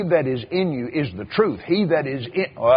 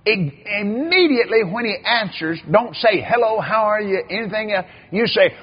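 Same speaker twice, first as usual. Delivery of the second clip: preacher giving orders in a raised, high voice; voiced other preacher; 8 semitones higher, 4 dB louder than usual. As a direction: mimic the older preacher insisting. Voicing another preacher's instructions.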